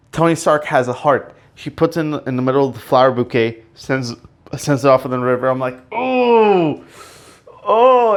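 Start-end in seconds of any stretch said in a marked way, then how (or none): 5.9-8.1 high, drawn-out pained "oh"; hammed-up moan of heartbreak; mock wail. Fan watching the flowers sent off, mock heartbroken wail. Reenacting reaction to funeral send-off.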